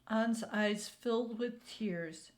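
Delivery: ordinary delivery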